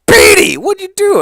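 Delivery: hammed-up high-pitched freakout; high mock Sanjay voice. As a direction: squeal it like a frantic buddy. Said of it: "he's all like".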